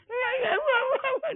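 high, slow tearful "iya"; whiny child giving in. Retold or imitated. Child giving in to the father, high tearful whimper. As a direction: whimper yes like a scared kid.

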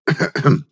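loud, deliberate throat clearing. Acted. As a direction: clear the throat loudly for attention. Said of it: "Громко прокашлялся я".

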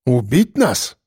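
glum, wary question; gloomy. A narrator's reading. Mordyukov questions the armed policeman, gloomily. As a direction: ask it sullenly. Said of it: "хмуро спросил Мордюков".

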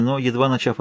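ordinary delivery

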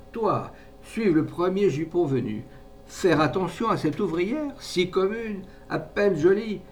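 high-pitched, indignant disdain; scornful reproach. A narrator's reading. Mother belittling the working girl, scornful.

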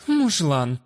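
playfully scornful, snorted out; mock snort. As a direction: snort it with fake disdain. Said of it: "Наигранно фыркнула женщина".

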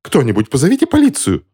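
high-pitched call for help; alarmed cry. A narrator's reading. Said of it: "закричала женщина в шляпке клош".

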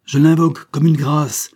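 loud, high impassioned plea; desperate cry. A narrator's reading. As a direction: implore him at full voice, in despair. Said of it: "s'écria le prince".

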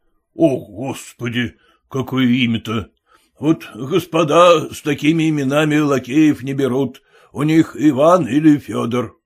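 ordinary delivery

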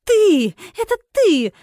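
high, loud outburst falling sharply; furious accusation. A narrator's reading. Spotty guest turns on the heroine, furious accusation. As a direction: cry out in angry recognition. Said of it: "её лицо перекосило от злости".

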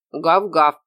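loud voiced dog woof; dog's keen agreement; eager bark. A narrator's reading. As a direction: bark a willing yes, lightly voiced. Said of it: "охотно подтвердила Колли".

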